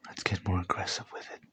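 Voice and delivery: soft unvoiced ASMR whisper, close up. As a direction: whisper each word right into the ear.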